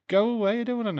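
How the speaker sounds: sudden high loud yell at the advert; high mock-annoyed yell; yelled go away. Real, not acted. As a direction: shout it at the screen, fed up. Shouting at advert playing.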